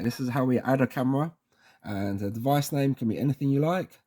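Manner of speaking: usual speaking voice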